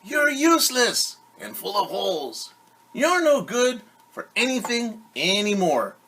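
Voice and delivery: mock yelling. A narrator's reading. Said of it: "began to yell at his hat".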